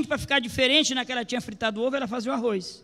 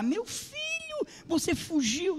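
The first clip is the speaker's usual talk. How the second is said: much higher, softer, slow weeping voice; mother crying; 8 semitones higher, 5 dB quieter, much slower than usual. Retold or imitated. Mother at the door weeping to her runaway son. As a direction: sob it out as the heartbroken mother. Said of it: "começou a chorar".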